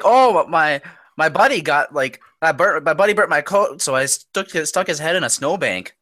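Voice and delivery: hammed-up Canadian accent; mock Canadian accent. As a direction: recount it like a Canadian hoser. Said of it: "There's that one life, he's like".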